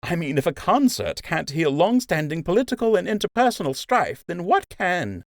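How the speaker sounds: mock the big meeting lightly; wry nurse Emma. Emma continues sarcasm.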